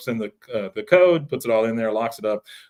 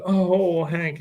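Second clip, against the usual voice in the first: high, wailing, acted dismay; mock tearful; 6 semitones higher than usual. Wife greeting her husband, mock tearful. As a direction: moan his name as if crying. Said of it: "she's, like, crying".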